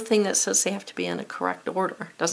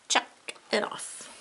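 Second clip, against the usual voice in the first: whisper; 3 semitones higher, 6 dB quieter, slower than usual. Real, not acted. Whispered self-reminder.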